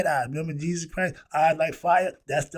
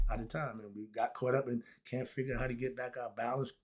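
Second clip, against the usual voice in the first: normal talking voice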